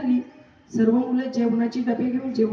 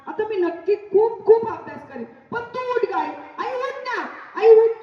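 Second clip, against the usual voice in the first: desperate pleading; 5 semitones higher than usual. Acted. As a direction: wail at her to wake up. Boy begging dead mother wake.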